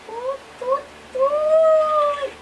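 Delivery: high, squealing toot toot; high playful horn toots; toot noise. Pretend. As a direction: squeal a toy horn sound. Imitating a horn while playing.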